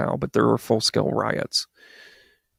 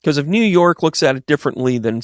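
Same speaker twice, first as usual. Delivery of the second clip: ordinary delivery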